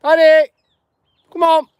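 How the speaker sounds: high, loud call to a dog. Real, not acted. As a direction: call out sharply across a field. Calling the dog over.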